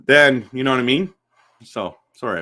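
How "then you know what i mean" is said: talking through a yawn; words stretched by a yawn. Real, not acted. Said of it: "I was yawning right there".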